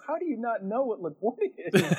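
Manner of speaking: disbelieving the driver, half laughing; a retold flash of panic, laughing. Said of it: "I was, like, in a panic".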